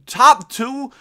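high, loud disbelieving question; real shock at the lottery. Real, not acted. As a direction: blurt it out in disbelief. Nuggets land lottery pick.

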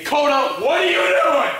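high, exasperated call; yelling at dog. Real, not acted. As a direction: yell at the dog.

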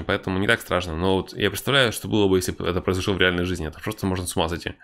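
ordinary delivery

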